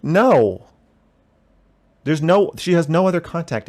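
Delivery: loud, high-pitched "no"; emphatic objection. Real, not acted. Streamer rejecting a viewer's "kinda extreme" remark, emphatic objection. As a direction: shoot the question down loudly. Rebutting chat's question about being extreme.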